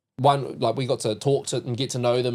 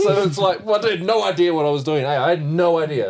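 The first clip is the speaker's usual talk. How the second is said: laughing; 5 semitones higher, 5 dB louder than usual. Real, not acted. Laughing about being clueless.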